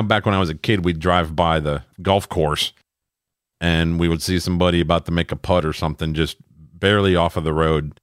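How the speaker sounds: ordinary talk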